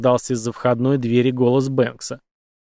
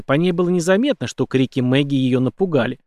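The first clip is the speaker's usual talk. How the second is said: usual speaking voice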